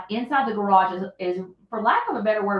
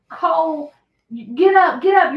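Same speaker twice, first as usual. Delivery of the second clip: urgent; 6 semitones higher, 8 dB louder than usual. Retold or imitated. Daughter waking brother.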